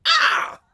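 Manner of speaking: piercing high scream; high shriek. Acted. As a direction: let out a shrill shriek. Medusa screams.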